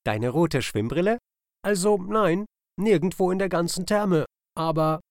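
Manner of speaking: a chirpy bird, confused by the question; puzzled kingfisher. Acted. Kingfisher answers the otter, puzzled, in a high bird voice. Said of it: "schüttelte er verwirrt den Kopf".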